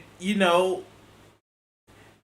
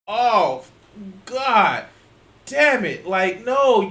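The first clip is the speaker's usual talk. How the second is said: irritated, higher-pitched outburst; real irritation; 4 semitones higher, 4 dB louder, much slower than usual. Real, not acted. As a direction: push each word out in exasperation. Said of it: "show you how irritated I am".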